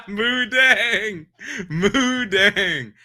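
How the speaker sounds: giggling through the words; laughing. Real, not acted. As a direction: laugh while repeating it. Laughing repetition.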